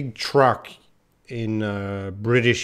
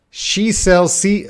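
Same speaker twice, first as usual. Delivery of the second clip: drawn-out, careful start of the twister; too slow; 7 semitones higher, 4 dB louder, slower than usual. Shown as a demonstration. Testing the twister slowly for viewers. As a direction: ease into each word slowly. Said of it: "I'm too slow".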